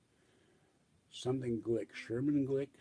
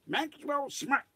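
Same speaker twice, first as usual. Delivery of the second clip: high practice of Maxwell Smart, lightly voiced; Maxwell Smart try; 14 semitones higher, slower than usual. Shown as a demonstration. Practicing the voice for listeners, light high Maxwell Smart. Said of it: "I have to really practice that".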